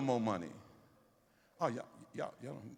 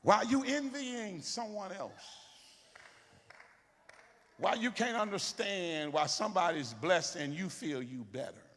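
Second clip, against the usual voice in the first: high, loud preaching rebuke; 9 semitones higher, 5 dB louder than usual. Acted. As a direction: challenge them, higher and louder.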